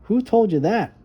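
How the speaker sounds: put-on high, eager child's voice; little girl voice. Little Violet telling her brother about the dark, high and earnest. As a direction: play the small sister talking herself brave.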